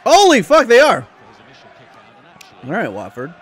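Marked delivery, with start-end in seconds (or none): 0.0-1.1 high, loud disbelief; surprised shout. Real, not acted. Streamer spotting Spurs losing, surprised shout.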